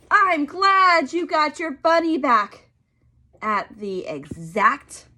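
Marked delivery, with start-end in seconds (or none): 0.1-2.7 put-on higher, louder kids' chorus; two happy girls at once; both girls together. The two girls to each other, high happy chorus. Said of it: "they both said".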